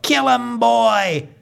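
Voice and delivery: high-pitched holler from the stands; egging-on yell. Acted. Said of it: "our neighbors yell".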